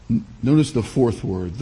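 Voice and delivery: usual speaking voice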